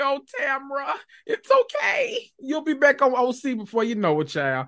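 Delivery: high, put-on soothing coo; mock comforting Tamra. Host pretends to console crying Tamra, high cooing voice. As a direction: croon it like consoling a crying friend. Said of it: "I was like".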